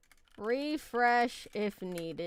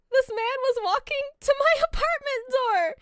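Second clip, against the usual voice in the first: high, rising, louder voice as the danger nears; panicked retelling; 5 dB louder than usual. Retold or imitated. Storyteller tells viewers the man reached the door, panicked. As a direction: retell it with mounting alarm, pitched way up. Frightened retelling of being followed.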